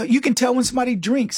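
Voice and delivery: ordinary delivery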